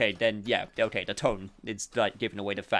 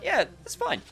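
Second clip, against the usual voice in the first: retold breezy reply. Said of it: "I, I went".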